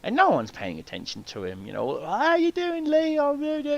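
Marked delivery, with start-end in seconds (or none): 2.0-3.8 high, airy mock greetings; tossed-off high-pitched small talk; mock chatter. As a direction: imitate distracted passers-by in a high voice. Mimics people greeting actor who was ignored.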